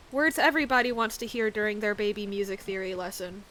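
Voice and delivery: normal talking voice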